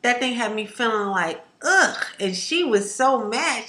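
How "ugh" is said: high disgusted groan; disgusted ugh. Real, not acted. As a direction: let out a grossed-out ugh. Disgusted grunt at itching.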